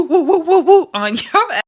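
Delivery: high, loud laughing woos. Retold or imitated. Vlogger quoting Janelle's joke, laughing high and loud. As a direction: crack up through the woos.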